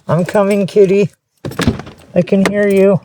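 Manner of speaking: sing-song pet-talk, calling out; coaxing kitty call. Real, not acted. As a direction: lure the hiding kitten with your voice. Calling to a crying kitten.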